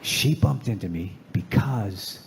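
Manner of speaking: very low, deadpan epiphany with long pauses; dramatic realization in a low voice; mock solemn revelation. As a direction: build to the punchline gravely, spacing each phrase. Mock revelation before the punchline.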